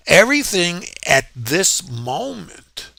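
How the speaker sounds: moment held high and slow; slow, high push on moment to make the point; stress on moment. Shown as a demonstration. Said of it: "stressing the word moment".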